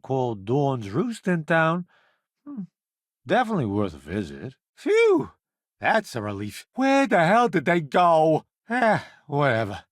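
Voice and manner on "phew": high, relieved exhale; relieved phew. Acted. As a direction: blow out the relief. Relief after danger.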